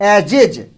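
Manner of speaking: एजेज as "e-jej", closing on a voiced j; two-syllable j ending. Shown as a demonstration. Teacher insists on the only reading, एजेज with a final j. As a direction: say एजेज in two beats, j in both. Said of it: "क्या पढ़ेंगे".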